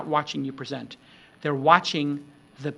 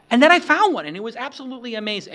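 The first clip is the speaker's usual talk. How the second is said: excited burst; 8 semitones higher, 8 dB louder, much faster than usual. Shown as a demonstration. Burst after hushed demo.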